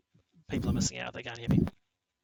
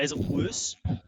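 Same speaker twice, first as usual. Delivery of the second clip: usual speaking voice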